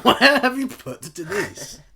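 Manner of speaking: loud, slow, high mock demand; mock outrage. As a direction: demand it back in hammed-up outrage. Mock demand about missing host.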